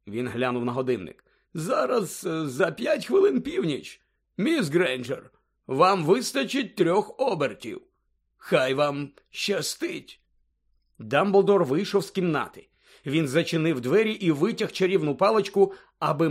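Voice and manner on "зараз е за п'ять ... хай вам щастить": slow, higher-pitched headmaster voice; deliberate words from an old wizard. Acted. Dumbledore giving instructions. (повільний голос старого директора)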